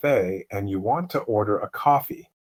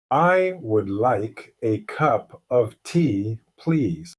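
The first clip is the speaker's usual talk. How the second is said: slow; slower than usual. Shown as a demonstration. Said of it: "I will say it very slowly".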